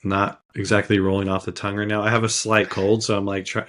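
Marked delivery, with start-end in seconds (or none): none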